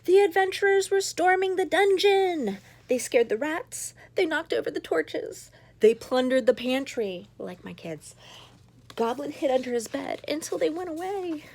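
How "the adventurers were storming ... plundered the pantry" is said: put-on bright, excited read-aloud to children; lively storytelling. A narrator's reading. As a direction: tell it big and bouncy for kids. Story narration.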